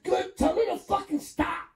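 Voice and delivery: high, sharp indignant comeback; indignant retort. Retold or imitated. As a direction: fire back, outraged. Retorting to principal, high pitch.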